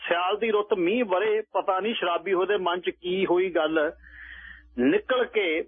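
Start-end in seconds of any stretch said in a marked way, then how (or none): none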